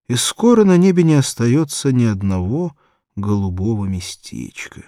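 ordinary delivery, nothing marked

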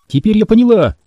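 high, loud accusation; accusing girl. A narrator's reading. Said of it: "продолжила Темпл".

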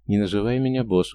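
plain reading voice

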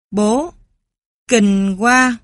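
bố kêu to, ghê tởm; kêu lên vì quá bừa. Acted. Bố kêu nhà bừa bộn. (bố la lớn)

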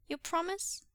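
high small-voiced question; solemn child. A narrator's reading. Son looks up at father for a promise, solemn. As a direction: ask solemnly in a little boy's voice. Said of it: "he asked solemnly".

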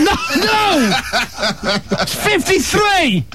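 laughing. Real, not acted. Laughing at a silly bingo call.

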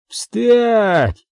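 high, drawn-out forceful command; growled order. A narrator's reading. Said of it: "прорычал он". (рычащий приказ, высоко и протяжно)

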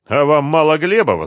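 loud, high challenging question; defiant. A narrator's reading. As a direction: challenge them to their faces. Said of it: "спросила с вызовом Римма".

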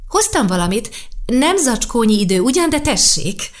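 put-on a child correcting a grown-up, bright and sure; little girl voice. Sasha correcting old Karl about the book, lightly voiced little girl.

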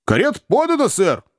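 high, brisk call from the doorway; servant's announcement. A narrator's reading. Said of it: "доложил Сэм".

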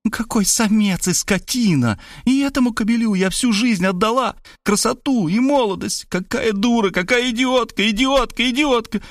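high, furious self-reproach; raging woman. A narrator's reading. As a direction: rant in bitter fury. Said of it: "Мария рвала и метала".

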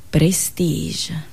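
ordinary delivery

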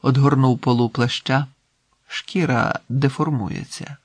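ordinary delivery

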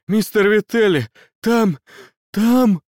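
high, breathless, gasping call; out of breath. A narrator's reading. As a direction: gasp it out, out of breath. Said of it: "Он никак не мог отдышаться".

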